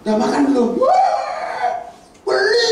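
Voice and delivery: high, loud laughing mock of a friend who mishears; clueless friend. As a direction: ham up the friend who doesn't get it. Mocking clueless friend's reply. (meniru teman yang tidak nyambung sambil tertawa)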